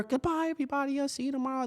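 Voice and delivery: a hint of carefree teacher leaving work; lightly cheerful goodbye. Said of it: "this and that, joking with".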